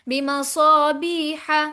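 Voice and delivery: slow chanted बिमसाबीहा; बिमसाबीहा with a held long ee; long madd. Shown as a demonstration. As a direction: stretch सा and बी in बिमसाबीहा. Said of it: "लंबा करेंगे".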